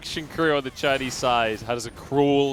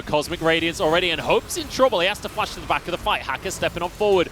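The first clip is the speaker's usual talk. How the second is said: rising, louder hype call; excited play-by-play; 7 semitones higher, 5 dB louder than usual. Acted. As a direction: build the call as the fight breaks. Live play-by-play building in a fight.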